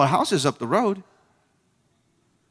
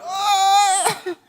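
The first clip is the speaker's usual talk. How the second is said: mock whimper. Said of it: "you're like".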